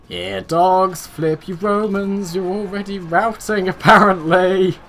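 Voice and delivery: mocking the routing Romans through a laugh; laughing taunt. Real, not acted. Gamer jeering at fleeing Romans, laughing. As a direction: jeer at them, laughing.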